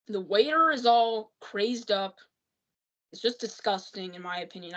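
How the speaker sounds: normal talking voice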